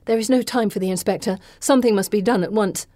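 usual speaking voice

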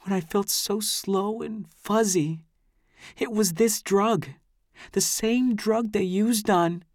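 put-on a high girl's voice, slow and troubled; slow, high, distraught young woman. A narrator's reading. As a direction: speak slowly as a shaken girl. Amy's voice in the narration.

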